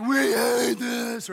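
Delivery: loud, drawn-out mock wail; exaggerated grumbling outburst; whiny group complaint. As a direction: whine it out long and loud like grumblers. Acting out the grumbling Israelites.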